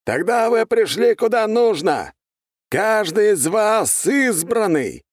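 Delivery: solemn, loud, high, slow proclaiming; loud soapbox ranting. A narrator's reading. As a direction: rouse the crowd from the stage. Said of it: "Торжественно провозгласил мужчина". (торжественная громкая речь с трибуны)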